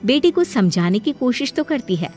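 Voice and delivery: ordinary delivery, nothing marked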